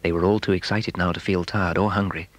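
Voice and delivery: usual speaking voice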